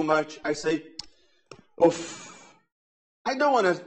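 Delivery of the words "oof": short irritated oof sigh; a clipped sound of annoyance; frustrated oof. Shown as a demonstration. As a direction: let out a fed-up oof. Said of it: "express my frustration".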